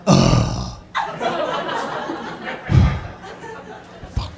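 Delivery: very low groan into a long sigh; groan, exhale, then curse wearily; reluctant cop's groan. Retold or imitated. Cop who wanted to go home, long weary groan. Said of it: "one of the cops went".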